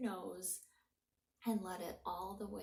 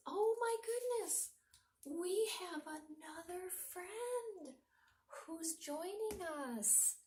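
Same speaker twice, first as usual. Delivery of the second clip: cooing pet voice; 8 semitones higher, 4 dB louder than usual. Real, not acted. Teacher greeting her cat on camera in a high cooing voice. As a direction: coo over the kitty like a delighted owner.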